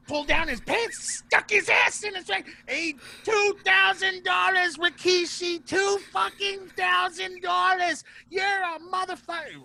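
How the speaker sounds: high, loud imitated screaming rant; mock enraged yelling. Retelling Rikishi's outburst, enraged.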